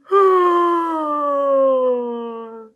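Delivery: loud high drawn-out yawn; huge yawn. Acted. Dog yawning hugely at Pig. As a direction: yawn as hugely as you can. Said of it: "he yawned a huge".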